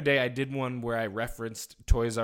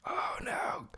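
whispering; 11 dB quieter than usual. Real, not acted. Whispering for sleeping baby.

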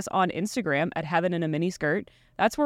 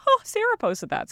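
surprised; 13 semitones higher than usual. Retold or imitated. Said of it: "I was like".

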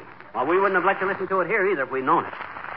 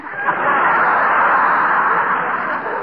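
hearty laugh; 7 semitones higher, 5 dB louder than usual. Real, not acted. Husband laughs at doctor's film.

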